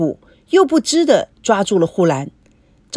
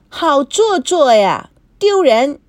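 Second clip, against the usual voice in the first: mock sneer; 4 semitones higher, 3 dB louder, slower than usual. A narrator's reading. Sneering at pretended fear.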